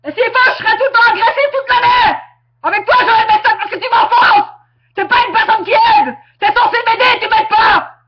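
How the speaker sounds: real furious shouting, voice cracking toward tears; angry outburst. Real, not acted. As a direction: yell it at her, hurt and enraged. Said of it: "je sais pas être calme".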